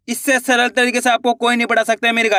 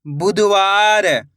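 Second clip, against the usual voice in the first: slow, drawn-out blending of the word; slow clear reading; much slower than usual. Shown as a demonstration. Teacher to pupils, blending the word slowly. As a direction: stretch each syllable for the pupils. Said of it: "मैं किस तरीके से एक एक अक्षर का उच्चारण".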